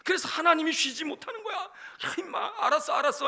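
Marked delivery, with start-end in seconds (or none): 1.6-3.3 high, mock-exasperated giving in; playful exasperation. God answering people who keep nagging, comically exasperated. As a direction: give in as if pestered, pitched high.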